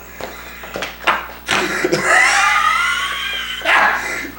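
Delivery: very high shrieking laughter; real laughter, breathless; howling laugh. Real, not acted. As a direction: double over laughing. Laughing at his own quote.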